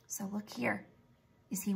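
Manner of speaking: whispered aside to the class. Real, not acted. Teacher pointing out a picture to pupils, whispered. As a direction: lean in and whisper.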